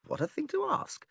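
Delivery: high, amazed "together?"; incredulous. Acted. Stranger scoffing at a request for both ways, incredulous.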